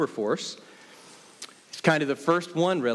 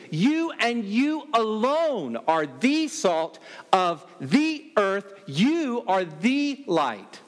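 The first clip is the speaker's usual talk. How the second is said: every "you" punched hard, slow and higher; emphatic you; 4 semitones higher, slower than usual. Shown as a demonstration. Preacher telling his flock they alone are salt, stressing "you". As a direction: drive each "you" home, slowly and higher. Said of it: "you" is emphatic at the very beginning".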